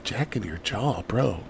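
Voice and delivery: quiet, muttered Jacking your jaw with a drawn jaw; mimicking the coach. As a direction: echo the coach's odd phrasing under your breath. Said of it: "Why does he say it like that?".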